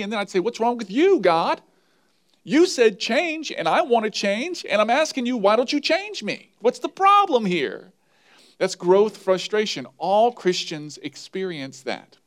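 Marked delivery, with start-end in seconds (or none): 0.4-7.9 exasperated complaint to God. Retold or imitated.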